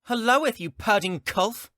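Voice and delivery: put-on high, bright cheeky kid greeting; cheeky child voice. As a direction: squeak it out like a bratty youngling. Child shiftling greets captain.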